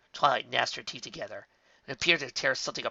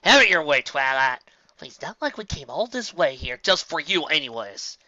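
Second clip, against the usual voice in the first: scoffing; 3 semitones higher, 7 dB louder than usual. A narrator's reading. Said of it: "Applejack's voice finally scoffed".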